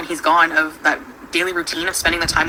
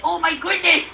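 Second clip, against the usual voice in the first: cooing pet voice; 12 semitones higher than usual. Real, not acted. Cooing to her cat.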